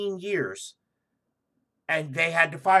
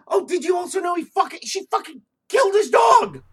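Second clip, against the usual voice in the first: outraged; 12 semitones higher, 9 dB louder than usual. Real, not acted. Outraged retelling.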